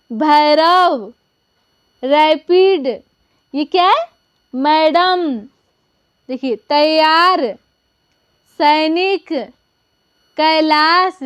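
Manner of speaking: each word read out clearly with pauses; teacher reading a word list to the class; reading aloud for class. Shown as a demonstration. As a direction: read the list slowly for pupils to follow. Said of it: "इसे भी हम एक बार direct पढ़ लेते हैं".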